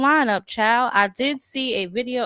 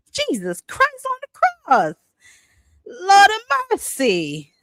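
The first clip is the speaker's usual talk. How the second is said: high, loud appeal to the Lord; high shocked church-lady exclaim; exasperated exclaim; 11 semitones higher, 6 dB louder than usual. Real, not acted. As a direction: cry out in scandalized disbelief. Reacting to scandalous video.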